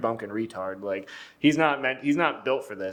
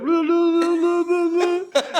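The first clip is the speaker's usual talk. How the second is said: high, loud wordless babble in imitation; hammed-up impression of a deaf man's vocalising; mock deaf man; 5 dB louder, slower than usual. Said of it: "did this to a deaf guy".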